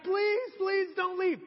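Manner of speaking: high, drawn-out mock begging; pleading. Church elders begging Paul not to leave, high plea. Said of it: "they just started begging him".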